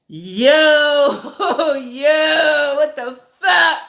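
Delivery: exaggerated wail of being mad as fuck; mad outburst. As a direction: shout in mock disbelief, half laughing. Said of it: "I was mad".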